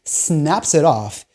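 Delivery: usual speaking voice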